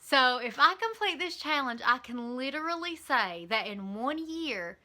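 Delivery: ordinary delivery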